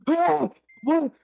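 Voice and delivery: startled wake. Acted. Waking up in shock.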